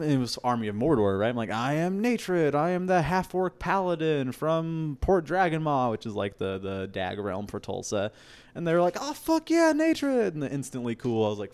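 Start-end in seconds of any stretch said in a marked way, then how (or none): 1.5-5.9 slow, high, proclaiming "I am Natred"; grand self-introduction. Retold or imitated. Introducing himself to Army of Mordor, grandly. 9.0-10.3 much higher mock cheer of "Natred"; crowd cheering. Fighters at the fire cheer his new name, excited.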